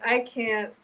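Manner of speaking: normal talking voice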